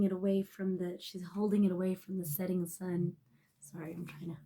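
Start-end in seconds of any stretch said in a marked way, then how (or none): 3.6-4.4 a real, broken whisper, close to tears; choked whisper. Real, not acted. Reader to Virgo, choking up in a whisper. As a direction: voice drops away as emotion hits. Choked up reading sad card.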